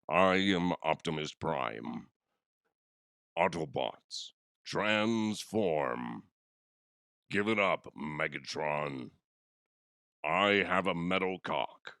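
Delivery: slow, weighty robot-leader voice; Optimus Prime. Acted. Podcaster showing off his sick voice as a robot hero, grand and slow. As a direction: intone it like a heroic robot commander. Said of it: "I was trying to do, um, Optimus Prime".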